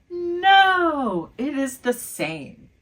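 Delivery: tr 1. Shown as a demonstration. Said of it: "Does it sound different".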